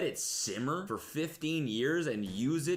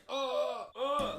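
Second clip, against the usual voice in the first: dying gasps; 14 semitones higher than usual. Pretend. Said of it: "your, like, dying words".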